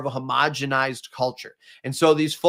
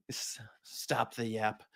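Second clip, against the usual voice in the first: Irish accent; 4 semitones lower, 14 dB quieter, slower than usual. Shown as a demonstration. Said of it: "Irish accent".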